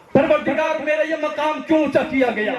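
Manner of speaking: high, urgent appeal; calling out to God. Acted. Dead man questioning his Lord about his rank, calling out.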